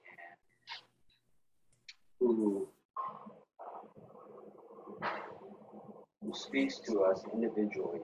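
soft, low prayer voice; hushed prayer. Real, not acted. Pastor praying for a church family, hushed prayer. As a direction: pray quietly, with halting pauses.

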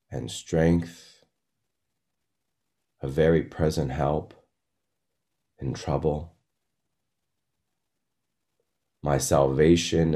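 reverent psalm recitation; prayerful scripture reading. Real, not acted. Leads group in prayerful scripture.

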